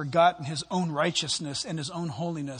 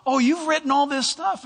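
higher, urgent caller breaking bad news; higher, urgent worried caller; worried board member; 6 semitones higher than usual. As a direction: voice the anxious friend on the phone, pitched up. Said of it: "And he said".

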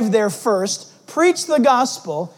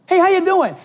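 bright, higher and louder friendly hello; cheery call; 8 semitones higher, 3 dB louder than usual. Retold or imitated. Greeting punks cheerfully.

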